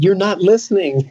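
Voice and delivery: high, amused insistence; emphatic retort. Retold or imitated. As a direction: correct him with a laugh. Retort to the stranger, laughing.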